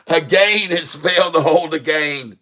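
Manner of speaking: hammed-up English accent, "gain" said as "geɪn"; mock English accent. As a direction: put on a clumsy English accent to land the pun. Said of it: "That's a dreadful English accent".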